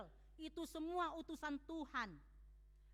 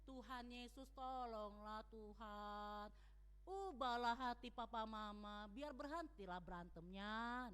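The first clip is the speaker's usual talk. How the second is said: slow, much softer, lower earnest plea; pleading prayer; 7 semitones lower, 6 dB quieter than usual. Shown as a demonstration. The right way a child prays to Jesus for the parents, slow soft earnest plea. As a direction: beg gently for help. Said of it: "enggak begitu".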